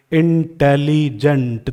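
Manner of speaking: इंटेलिजेंट said slowly, in-te-li-gent; इंटेलिजेंट pronounced very slowly, each syllable separate; syllables drawn out. Shown as a demonstration. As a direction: stretch इंटेलिजेंट to show its vowels. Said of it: "in में एक vowel sound हो गई".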